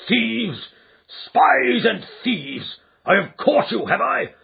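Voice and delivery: the fat man raging, shouting threats; raging shriek. Acted. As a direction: rage at the thieves, slowly. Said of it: "he shrieked".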